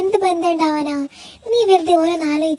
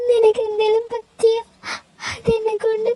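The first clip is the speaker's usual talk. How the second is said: crying confession; 2 semitones higher than usual. Acted. Girl tells Alex she couldn't bear losing him, in tears. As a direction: choke the words out through tears.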